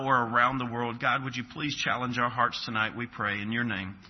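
soft, low pulpit prayer. Acted. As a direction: pray quietly and earnestly for the congregation. Prays before the sermon.

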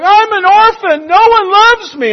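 high, loud, self-pitying whine; mock whining orphan. Believer mocked as a self-pitying orphan, loud high whine. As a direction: whine like a lost, unloved child. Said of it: "running around going".

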